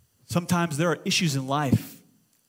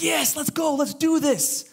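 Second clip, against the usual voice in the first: high, pumped-up celebration; acted eager exclamation; excited cheering; 10 semitones higher, slower than usual. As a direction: cheer as if prayers are finally answered. Acting out thrilled answered pray-er.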